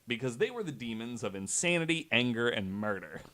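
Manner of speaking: usual speaking voice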